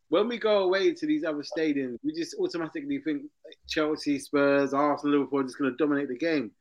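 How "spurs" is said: usual speaking voice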